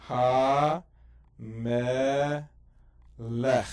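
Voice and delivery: Ha, me, lech said loud with pauses between; syllable by syllable. Shown as a demonstration. Teacher sounds out hamelech syllable by syllable, loud. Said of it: "we go syllable by syllable".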